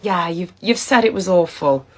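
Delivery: normal talking voice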